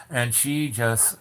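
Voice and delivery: ordinary talk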